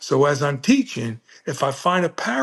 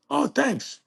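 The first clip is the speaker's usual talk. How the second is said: mock hurt; 10 semitones higher than usual. Teacher reacts to insult.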